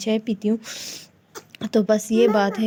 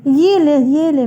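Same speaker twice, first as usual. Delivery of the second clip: slow, louder mock little boy's plea; higher, slow childish echo of her son; imitating child; 4 semitones higher, 6 dB louder, much slower than usual. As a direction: stretch it out like a small kid begging. زین کہتا ہے.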